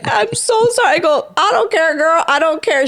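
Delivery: excited. Retold or imitated. Joyful retold exchange, high.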